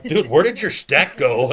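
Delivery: loud, slow disbelieving shout; shocked. Retold or imitated. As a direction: yell it in shock at the table. Shocked at friend's lost chips.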